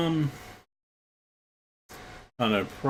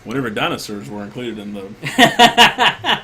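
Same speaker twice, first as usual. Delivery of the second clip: loud, slow, through laughter; laughing it out loudly; 10 dB louder, slower than usual. Real, not acted. Joking about peaking in school.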